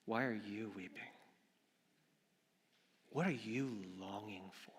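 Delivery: soft, tender questioning; hushed, compassionate voice of Jesus; gentle. Acted. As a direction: ask it softly, with care. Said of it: "Jesus is so gentle".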